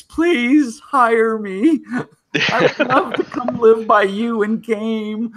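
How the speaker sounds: high, slow, laughing plea; begging. Retold or imitated. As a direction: whine it out like a beggar. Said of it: "I was basically begging Tex".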